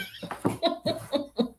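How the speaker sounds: high, light giggle; soft high-pitched chuckling at your own joke; amused laugh. Real, not acted. Streamer jokes about a grim Valentine gift, amused laugh.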